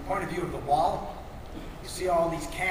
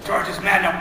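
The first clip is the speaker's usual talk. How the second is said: ordinary delivery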